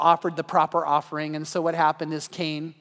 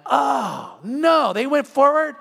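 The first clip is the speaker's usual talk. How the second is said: higher, louder mock horror; mock dismay; 6 semitones higher, 5 dB louder, much slower than usual. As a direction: act out the shocked pastor. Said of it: "I'm like".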